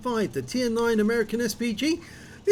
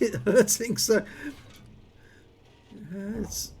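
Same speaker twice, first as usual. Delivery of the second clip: normal talking voice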